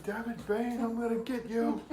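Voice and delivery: high, strained mock yell held back in volume; angry threat. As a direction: threaten in comic outrage. Poked Kenpachi swings sword at Bane.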